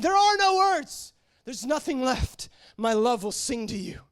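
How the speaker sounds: high, slow, anguished devotion; high tearful-style plea, falling as it goes; crying out in worship. Retold or imitated. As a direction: cry the words out to God, drawing them long. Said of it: "now I'm crying as I'm driving".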